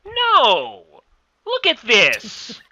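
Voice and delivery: incredulous outburst. Acted. Character argues excitedly.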